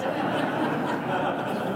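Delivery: breathy laugh at his own joke; a quick, amused chuckle; light laugh mid-sentence; real laugh. Real, not acted. Self-deprecating joke about CIA.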